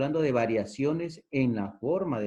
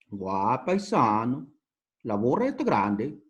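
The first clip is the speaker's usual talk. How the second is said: a touch of northern Peruvian Piura lilt; imitating a Piura local's sing-song; Piura accent. Teacher imitating a Piura speaker for the class, light Piura accent. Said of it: "Así hablan".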